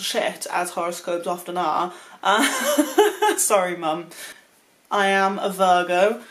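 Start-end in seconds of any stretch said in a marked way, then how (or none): 2.2-4.1 laughing. Real, not acted. Swearing then apologizing to Mum.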